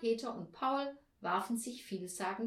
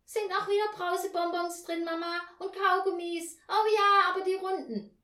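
put-on high, loud child's voice; eager child; 10 semitones higher, 8 dB louder than usual. A narrator's reading. Child asks Mama about favourite sweets, high and eager. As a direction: pipe up excitedly like a child.

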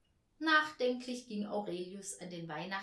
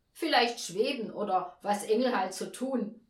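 raised-voice heckle; loud call; 2 semitones higher, 9 dB louder than usual. A narrator's reading. Guest suggesting tricks to the angel, loud heckling. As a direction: toss it out loudly over the chatter. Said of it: "rief ein Gast laut".

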